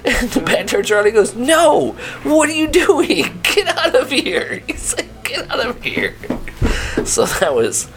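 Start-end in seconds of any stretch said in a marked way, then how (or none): 1.3-4.6 much higher, slow mock scold; mock scolding coworker. Retelling Pat chasing the pepper thief out, high and slow. 5.2-6.1 high mock scolding. Pat scolding, repeated.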